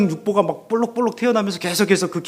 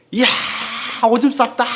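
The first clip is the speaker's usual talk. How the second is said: higher, gleeful "오줌 쌌다"; delighted cheer; 6 semitones higher than usual. Retold or imitated. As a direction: celebrate it like good news. Parent cheering baby peeing.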